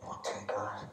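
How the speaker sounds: whispered relief, very soft; relieved whisper. Real, not acted. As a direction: breathe it out in a whisper. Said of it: "nerves before playing".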